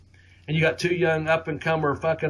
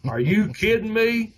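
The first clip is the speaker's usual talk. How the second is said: higher-pitched, laughing disbelief; incredulous; 7 semitones higher than usual. Retold or imitated. Wrestler recalling his reaction to three more bumps, incredulous. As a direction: voice jumps up on a laughing question.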